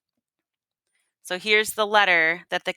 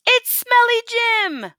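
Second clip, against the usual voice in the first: high, slow alarmed scream; 5 dB louder, slower than usual. Acted. Townsfolk screaming at the outlaw sighting. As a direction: shriek the alarm to the whole town.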